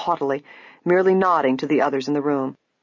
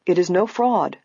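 ordinary delivery, nothing marked

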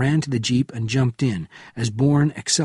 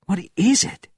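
high, urgent question; urgent young Soviet; 10 semitones higher than usual. A narrator's reading. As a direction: ask it in a raised, urgent pitch. Young Soviet's startled question in story.

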